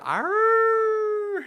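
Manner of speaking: high, loud mock pirate cry; spooky roar. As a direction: belt out a cartoon growl. Spooky video sound imitated.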